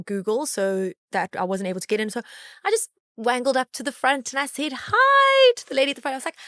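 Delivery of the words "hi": 1 bright hi. Retold or imitated. Cheery greeting to door lady.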